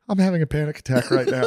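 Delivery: high-pitched imitation of a panicking friend; retold panic, played for laughs; mock panic. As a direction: voice jumps up, acting out a panic attack. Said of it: "Gurman had a panic attack as soon as we sat down".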